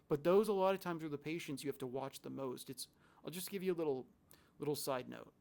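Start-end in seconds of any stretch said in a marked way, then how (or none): none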